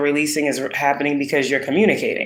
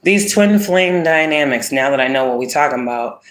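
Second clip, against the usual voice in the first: ordinary delivery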